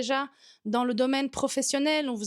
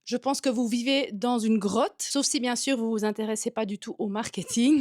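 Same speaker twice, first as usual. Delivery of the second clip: ordinary talk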